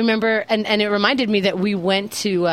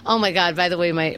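ordinary delivery

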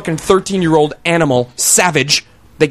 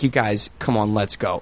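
calm, soft coaxing; gently herding the kids along; not yelling; 6 semitones lower, 6 dB quieter than usual. Retold or imitated. Chaperone coaxing kids out of the store, softly. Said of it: "Like, I'm not yelling".

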